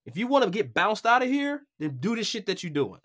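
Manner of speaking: put-on Michael Eaves warning the young broadcaster, firm; stern mentor warning. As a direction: deliver it like a no-nonsense boss. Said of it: "he's like".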